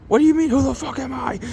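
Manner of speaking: high, put-on outraged voice; indignant character. Reading Peacemaker's comic line aloud, high and outraged. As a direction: squeak out the outrage.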